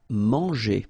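mahn-zhay, nasal first vowel; French word. Shown as a demonstration. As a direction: say Manger the French way.

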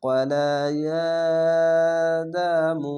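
"ইয়া" stretched slowly in "কলা ইয়া দা মু"; three-alif elongation. Shown as a demonstration. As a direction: hold the vowel of "ইয়া" long and level. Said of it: "সেখানে তিন আলিফ টান হবে". ("ইয়া" তিন আলিফ টেনে ধীরে পড়া)

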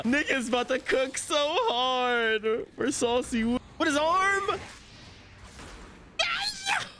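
high, stretched-out squeals of excitement; ecstatic hype. Real, not acted. Fan raving about the studio's work, ecstatic high cries. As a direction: draw it out high, bursting with hype. Hyped by the animation quality.

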